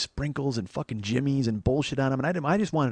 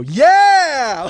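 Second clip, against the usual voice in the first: high, very loud triumphant cheer; excited yell; 13 semitones higher, 14 dB louder than usual. Retold or imitated. Man finds his tape measure, excited yell. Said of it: "Like, I'm all excited".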